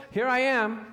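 spring up and answer at once; a boy answering eagerly. Retold or imitated. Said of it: "he went to Eli and said".

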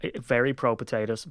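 ordinary delivery

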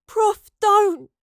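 high, loud cry of protest; desperate plea. A narrator's reading. Astrid begging the professor not to leave, desperate. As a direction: beg at the top of the voice. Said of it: "Astrid pleaded".

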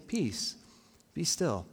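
soft, calm, each phrase falling to a full stop; gentle, settled statement; periods at the end. Acted. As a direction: soothe quietly, pausing between phrases. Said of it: "with, like, periods at the end".

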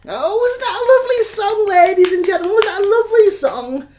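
high, loud showman hype; hyping the song to ladies and gentlemen, excited; excited host. Acted. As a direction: gush to the crowd like a host. Hosting, hyping song to audience.

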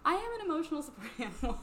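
fast high giggly; laughing. Real, not acted. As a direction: blurt it out laughing. Laughing joke.

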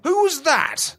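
high-pitched outraged cry; mock scream. Boxer demands who insulted him, high mock scream. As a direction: pitch it way up in indignation without full volume. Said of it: "screamed Muhammad Ali".

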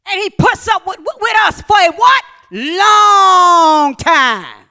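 shouted preacher's call, then a long held answer; rising to a loud, stretched "Long time"; preaching at full force. Acted.

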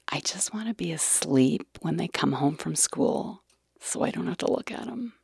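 a breathy whisper of a tired wish; whispered inner thought. Retold or imitated. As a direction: whisper it as if to yourself, wanting to hide. Wanting to sleep, avoiding kids.

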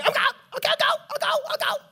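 high-pitched, repeated squeal; comically high little voice; mock eager agreement. Mimics own eager giving in.

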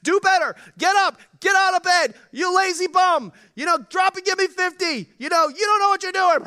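high, loud barking drill sergeant. Retold or imitated. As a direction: scream orders like a drill sergeant. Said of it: "military guy screaming in your face".

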